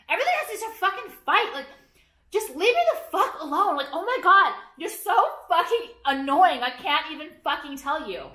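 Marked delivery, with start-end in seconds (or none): 0.1-6.6 higher, fast, fed-up outburst; exasperated rant. Real, not acted. Venting at trolls on her vlog, exasperated rant. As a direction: vent it, really fed up.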